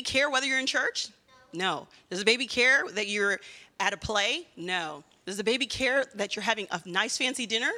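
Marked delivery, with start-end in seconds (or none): none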